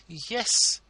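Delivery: high, effusive ladylike voice; fawning hostess; gushing society lady. A narrator's reading. Mrs Lammle voiced by narrator.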